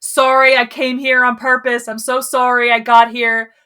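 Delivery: loud, frantic mock apologizing; apologetic tourist. Tourist apologizing to New Yorkers, loud and grovelling. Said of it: "It's like".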